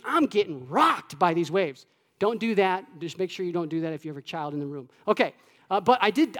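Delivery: normal talking voice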